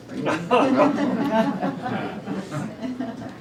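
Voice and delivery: big rising laugh at the joke; hearty laugh. Real, not acted. Speaker laughing at his own comic strip, hearty laugh.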